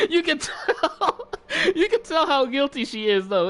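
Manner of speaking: high, laugh-filled words. Real, not acted. Teller amused by grandma's obvious guilt, laughing. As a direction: crack up while talking.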